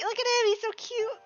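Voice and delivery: ordinary delivery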